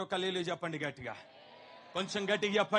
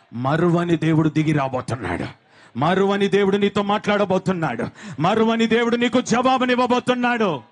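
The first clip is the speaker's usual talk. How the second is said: loud proclaiming preacher, every phrase hammered; full-force preaching; 3 semitones lower, 12 dB louder than usual. Acted. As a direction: preach it out to the whole hall at full volume. Preacher proclaiming God's answer. (బిగ్గరగా బోధించే ప్రసంగ స్వరం)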